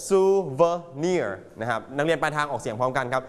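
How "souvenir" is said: "Souvenir" slowly, syllable by syllable, sou-ve-NIR; stress on last syllable. Shown as a demonstration. Teacher drilling class on stress, sou-ve-NIR in "Souvenir". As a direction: break up "Souvenir" and land on "nir". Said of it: "Stress on the last syllable".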